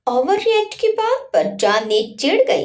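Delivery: ordinary talk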